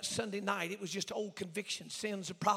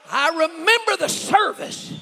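high, loud, drawn-out cry; full-force preaching; 9 semitones higher, 15 dB louder, slower than usual. Acted. Preacher opening his testimony to the church, slow full-force preaching. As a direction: call it out slowly, high and loud.

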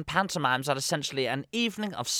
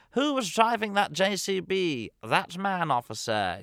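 panto call and response; 8 semitones higher than usual. Retold or imitated. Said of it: "as we used in Ireland".

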